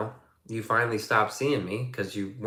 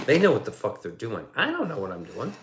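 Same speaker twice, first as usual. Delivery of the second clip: normal talking voice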